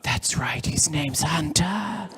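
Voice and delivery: whispered, drawn-out stage aside; hushed aside. Acted. Mum telling others her son's name, hushed aside. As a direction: confide it in a stretched whisper. Mum's smug aside to onlookers.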